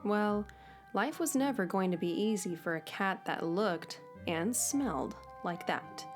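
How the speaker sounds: plain reading voice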